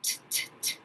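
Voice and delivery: "T, t, t," whispered, short repeated t sounds; teacher isolating the first sound of taco; isolated first sound. Shown as a demonstration. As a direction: tap out "T, t, t," softly, no voice. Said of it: "I hear the sound t".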